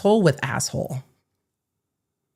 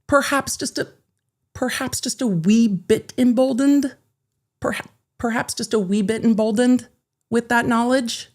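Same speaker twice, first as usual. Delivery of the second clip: coy understatement; 5 semitones higher than usual. Acted.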